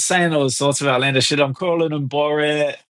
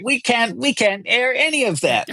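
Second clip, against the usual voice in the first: imitating producer; 8 semitones higher than usual. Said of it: "the American producer was like".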